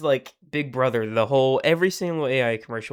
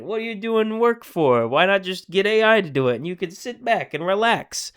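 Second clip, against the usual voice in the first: pitchman voice; 6 semitones higher than usual. Retold or imitated. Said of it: "he's just like".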